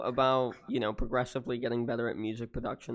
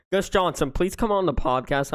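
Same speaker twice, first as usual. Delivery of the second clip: louder, higher begging call-out; pleading shout; 5 semitones higher, 5 dB louder than usual. Real, not acted. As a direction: beg him loudly, half joking. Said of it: "I'm actually begging".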